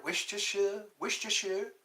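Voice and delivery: Worcestershire as "wish-tuh-shuh", unsure and questioning; tentative pronunciation of Worcestershire. Shown as a demonstration. Vlogger checking with viewers, Worcestershire said tentatively as "wish-tuh-shuh". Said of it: "did I say it right?".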